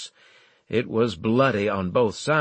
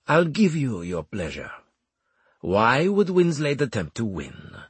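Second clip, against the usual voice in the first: theatrical commander posing mocking questions; Armel, scornful; 2 semitones higher than usual. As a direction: indulge him grandly, with rising incredulity.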